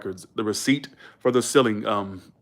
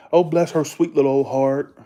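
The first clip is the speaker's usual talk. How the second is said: louder, syrupy Southern sympathy; Southern accent demo; 3 semitones higher, 6 dB louder than usual. Shown as a demonstration. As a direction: coo it like a Southerner. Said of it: "I miss hearing that".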